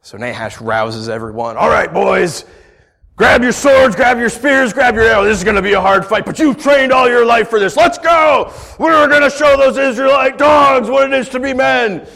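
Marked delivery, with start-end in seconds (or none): 1.6-12.1 loud, high battle call; rousing war cry. Retold or imitated. Preacher voicing King Nahash rallying his soldiers, loud and high. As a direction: fire up the troops for a fight.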